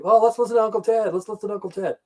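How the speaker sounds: high, lightly voiced party buddy; mock excited friend. A friend at a party begging for [name], mild mock voice. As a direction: chirp it like a stoned teen friend. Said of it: "That's what I was saying".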